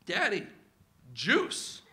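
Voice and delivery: a lightly voiced toddler asking, juice lilting up; simple little-kid plea. Two-year-old asking dad for juice, small rising plea.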